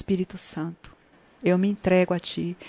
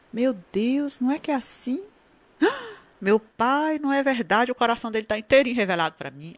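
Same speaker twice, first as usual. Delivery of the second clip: higher, a gasp of playful discovery; playful mock realization; 5 semitones higher, faster than usual. Wife realizing God showed her husband's heart, playfully amazed. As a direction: act out a wife suddenly seeing the truth. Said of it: "Tô falando em tom de brincadeira".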